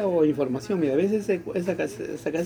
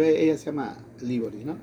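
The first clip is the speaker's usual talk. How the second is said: ordinary delivery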